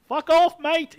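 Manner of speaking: grating, high-pitched Aussie-British yell; high, loud caricature of an Australian-British accent; mock Australian-British accent. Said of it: "that Australian, British, Welsh accent just grates in your head".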